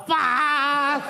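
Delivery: high, laughing swear; a laughing yelp of a swear; laughing curse. Retold or imitated. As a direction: blurt it out mid-laugh. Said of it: "he's like, just laughing".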